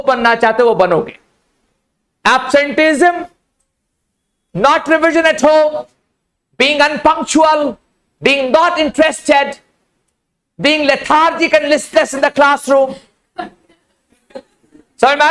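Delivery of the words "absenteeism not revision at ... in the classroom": each item higher and spaced out very slowly; slow emphatic list. Shown as a demonstration. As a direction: tick off each bad habit slowly and pointedly. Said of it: "listing bad habits slowly, emphatic". (धीमे, ज़ोर देकर गिनाते हुए)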